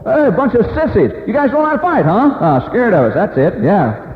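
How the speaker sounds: high, loud jeering from the wall; mocking taunt. Townspeople jeering down at the marching soldiers, loud mocking taunts. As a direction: taunt them like a heckler on the city wall.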